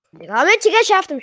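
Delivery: loud, high triumphant yell; excited shout. Real, not acted. Gamer to his stream after getting the sword, loud excited shout. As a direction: cheer it out like you just won the fight. Gamer cheering mid-fight.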